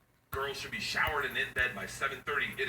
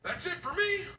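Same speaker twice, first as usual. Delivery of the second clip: high, prim mock voice; mock goody-goody; 13 semitones higher than usual. Dad mocking wife's sober bedtime rules. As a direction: parody the proper dad.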